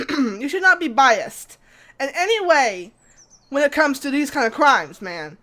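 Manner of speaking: hammed-up outrage, leaning hard on the words; indignant scolding. Detective scolds a police officer for taking sides, indignant.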